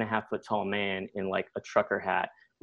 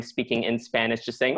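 ordinary delivery

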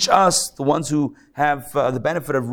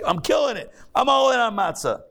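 high, gushing mock boast; 8 semitones higher than usual. Rabbi mocking a proud matzah eater, high gushing boast. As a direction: ham up a smug show-off bragging.

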